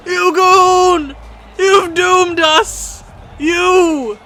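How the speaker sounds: high, loud furious screaming at the goalie; screaming rant. Real, not acted. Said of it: "I don't wanna scream".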